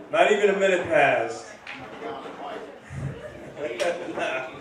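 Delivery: normal talking voice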